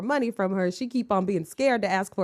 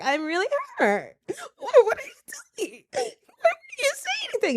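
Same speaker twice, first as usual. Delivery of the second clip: very high, tearful mock whimper; hammed-up crying, like a wounded girlfriend; mock hurt woman. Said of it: "She was sitting up there just like".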